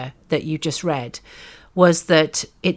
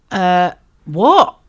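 stunned; 7 dB louder than usual. Retold or imitated. Retold stunned reaction.

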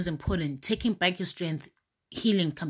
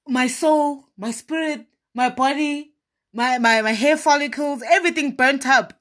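raised and louder, reliving the rage; half-playful angry outburst, higher and louder; retold anger; 6 semitones higher, 5 dB louder than usual. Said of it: "I was so angry".

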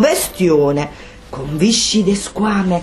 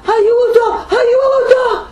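screaming for help; 12 semitones higher, slower than usual. Acted. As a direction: shriek it like a terrified witch. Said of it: "Grida Rossella".

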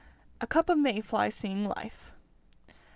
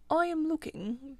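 a slightly higher voice for a story animal; lightly voiced Mole; 6 semitones higher than usual. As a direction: voice the Mole lightly, lifted above the narration.

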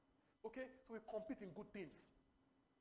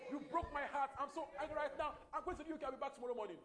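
much higher and louder hammed-up fake fury; mock angry rich person; 8 semitones higher, 10 dB louder than usual. Preacher acting a rich person leaving for the UK in a huff, loud mock fury. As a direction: play the spoiled rich lover storming off.

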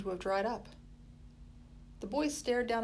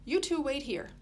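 higher brisk Molly, lightly voiced; Molly's voice; 6 semitones higher, 4 dB louder than usual. A narrator's reading. Molly handing food to the boys, lightly voiced brisk. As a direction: hand it over briskly and motherly.

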